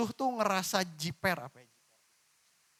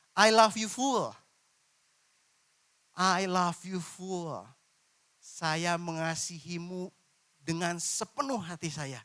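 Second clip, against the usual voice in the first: slow tender prayer; slower than usual. Shown as a demonstration. Preacher models a tender prayer to God. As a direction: pray it slowly like a lover's vow.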